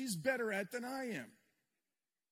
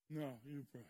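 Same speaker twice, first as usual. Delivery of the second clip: low, much softer, sleepy pushback; tossing it back, too tired to lead; exhausted reply; 10 semitones lower, 11 dB quieter than usual. Retold or imitated. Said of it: "Oh, we were bone tired".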